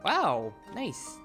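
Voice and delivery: ordinary delivery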